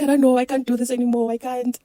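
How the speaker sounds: high, loud desperate crying plea. Retold or imitated. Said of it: "I'm crying".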